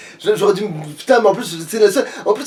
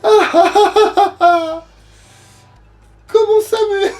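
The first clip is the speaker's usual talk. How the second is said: a much higher sorceress casting a curse; witch voice; 9 semitones higher than usual. Acted. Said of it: "je vais faire cette voix en fait".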